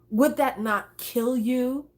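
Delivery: usual speaking voice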